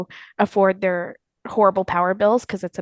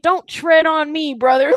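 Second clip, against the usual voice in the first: mock patriot; 7 semitones higher, 5 dB louder, slower than usual. Parodying Florida locals at protesters, hammed-up drawl. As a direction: ham up a gun-loving Floridian. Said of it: "and, like".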